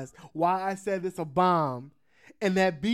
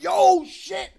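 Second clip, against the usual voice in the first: panicked inner voice; 10 semitones higher, 9 dB louder, slower than usual. Retold or imitated. Inner panic over hearing a bomb.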